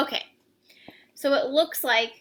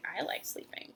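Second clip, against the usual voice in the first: whispered aside; 2 semitones lower, 12 dB quieter than usual. Real, not acted. Aside to kids while reading.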